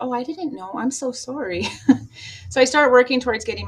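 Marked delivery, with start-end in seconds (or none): 0.0-1.7 higher, mock-sorry little voice; playing innocent to get away with it; fake innocence. Manipulator imitating her fake apology, higher and mock-sorry. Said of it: "I could play it off".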